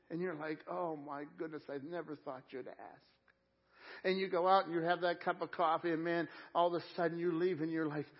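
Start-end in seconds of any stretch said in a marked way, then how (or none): 0.7-3.0 soft, understated amazement, dropping away; quiet grateful surprise. Acted. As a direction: play someone quietly touched to be asked. Acted grateful reply to an invitation.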